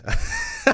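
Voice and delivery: loud high laugh; burst of laughter. Real, not acted. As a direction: cracks up loudly. Laughing at bad mic.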